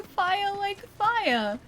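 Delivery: "fire" with no final R, high and drawn out; non-rhotic fire. Shown as a demonstration. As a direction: say "fire" high and slow, ending in "uh". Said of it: "don't really pronounce the, the R part".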